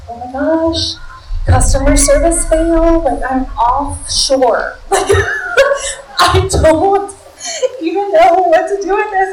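high, loud exasperated outburst; frustrated. Retold or imitated. Caller reaching an offshore helpline, frustrated. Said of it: "and I was so frustrated".

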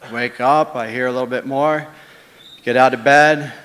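usual speaking voice

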